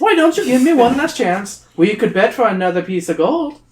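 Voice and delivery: high coaxing troll; wheedling troll. Acted. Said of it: "he wheedles".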